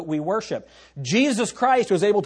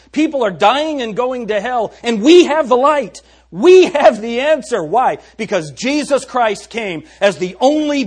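full-force preaching; 6 semitones higher, 8 dB louder than usual. Acted.

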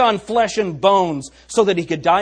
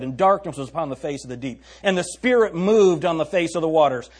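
ordinary talk

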